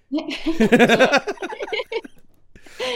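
laughing while reassuring. Real, not acted. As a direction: giggle through the words. Reassuring a passerby through laughter.

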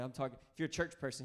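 usual speaking voice

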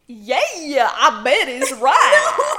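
slow twangy drawl. Acted. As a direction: lay the twang on thick. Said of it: "You're still doing it too twangy".

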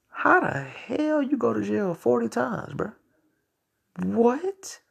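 high, slow, disbelieving; can't believe anyone went to jail forty times; incredulous. Real, not acted. Podcaster asking listeners in high-pitched disbelief.

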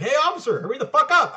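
imagined shout out the car window at the cop; pretend yell. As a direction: holler it impatiently, half-joking. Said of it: "yell out the window".

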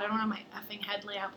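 unvoiced, quick panicked whisper; whispered retold swearing. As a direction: hiss it under your breath in dismay. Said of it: "I was like".

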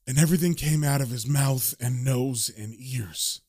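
low, subdued, grim recollection; haunted comic-book narrator. Acted. Reads Constantine's narration as shaken witness.

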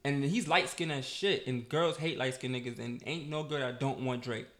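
ordinary talk, nothing marked

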